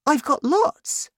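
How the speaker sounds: put-on very high, eager boy; high-pitched excited child; young boy's voice. A narrator's reading. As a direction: pipe up proudly in a boy's voice. Boy answers his father.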